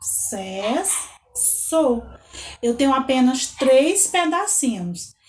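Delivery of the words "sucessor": "Sucessor" in three beats, su, ces, sor; separate, drawn-out syllables in "Sucessor". Shown as a demonstration. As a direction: count out "Sucessor" with a pause after each syllable. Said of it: "falar essa palavra no dedinho".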